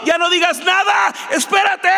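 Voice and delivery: high-pitched acted cry; emphatic interruption. Father stopping his son's apology, crying out emphatically.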